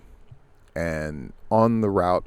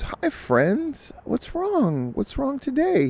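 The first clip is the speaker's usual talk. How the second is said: much higher, sing-song overly chipper greeting; forced cheery; slower than usual. Acted. Jogger greeting the woman with forced cheer, much higher sing-song. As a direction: put on a bright fake-friendly voice.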